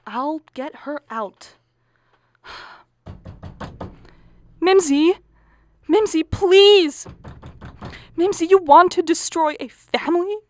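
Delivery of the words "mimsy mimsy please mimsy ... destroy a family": loud high pleading building to a furious yell; beg, then demand she open up; mother shouting at the daughter locked in the bathroom. Acted.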